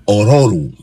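lightly put-on African accent. Shown as a demonstration. Husband guesses the African way of saying it, African accent. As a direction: try the name in an African accent. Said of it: "if you say it in African".

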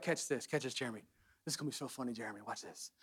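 low, hushed conspiratorial aside; confiding God. Acted. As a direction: softly let him in on the joke. God confiding a joke to Jeremy.

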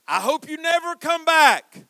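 shouted high and loud, bitter father; furious father's shout at his son; angry shout. Retold or imitated.

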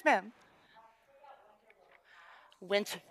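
ordinary talk

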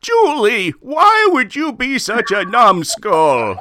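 high, slow curmudgeon voice; Jack. Shown as a demonstration. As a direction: drag it out high like a cranky curmudgeon. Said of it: "a voice that sounds like this".

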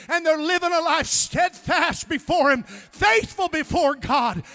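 loud, driving sermon rising in pitch; fervent revival preaching; full-force preaching. Real, not acted. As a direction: drive every phrase home to the congregation at full volume.

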